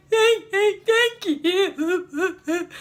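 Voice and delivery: high, hammed-up blubbering thanks; mock ugly crying. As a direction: imitate sobbing through a thank you. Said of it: "You know, ugly crying and stuff".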